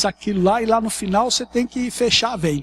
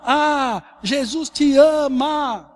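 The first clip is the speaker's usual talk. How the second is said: imitação de gritos de rua, alta e arrastada; grito de grupo imitado; 6 semitones higher, 6 dB louder, much slower than usual. Retold or imitated. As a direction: grite alongando as vogais, como um grupo evangelizando na rua. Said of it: "e só gritava assim". (gritando, mais agudo e bem lento)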